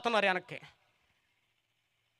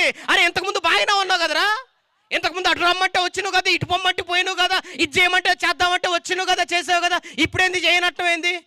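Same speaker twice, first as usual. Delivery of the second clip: higher, taunting scolding; mocking neighbours; 11 semitones higher, 6 dB louder than usual. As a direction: needle him for changing. Neighbours mock Jairus. (హేళనగా ఎత్తైన స్వరం)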